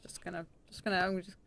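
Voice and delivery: hushed unvoiced aside; whispering. Real, not acted. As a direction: whisper it under your breath. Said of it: "whisper measured".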